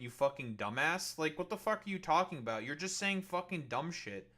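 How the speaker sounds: normal talking voice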